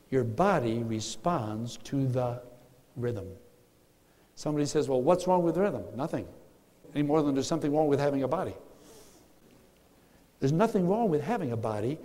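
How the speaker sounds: ordinary talk, nothing marked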